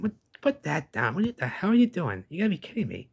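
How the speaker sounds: 2 high, startled "what are you doing"; 3 half-mock scolding of a prank; 4 mildly voicing Jay's disbelief; 1 incredulous scolding. Jay scolding the wizard.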